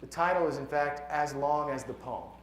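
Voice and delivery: normal talking voice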